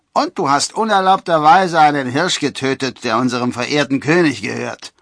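loud, high, indignant charge; accusing. A narrator's reading. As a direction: pile on the accusation at full voice. Second forester adds an accusation.

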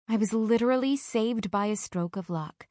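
ordinary delivery, nothing marked

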